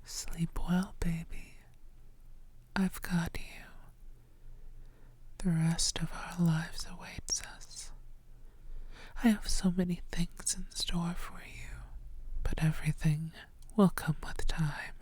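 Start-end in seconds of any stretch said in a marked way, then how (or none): none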